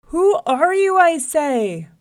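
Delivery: very loud, high, insistent demand; angry holler. A narrator's reading. Mad lion challenges his reflection, hollering. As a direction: shout it down a well, losing your temper. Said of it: "he hollered down the well again".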